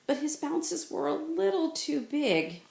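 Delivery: ordinary delivery, nothing marked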